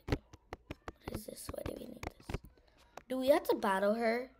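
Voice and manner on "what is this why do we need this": whisper. Real, not acted. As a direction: whisper it, puzzled. Whispered wondering.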